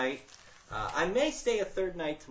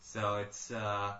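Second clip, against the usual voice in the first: ordinary talk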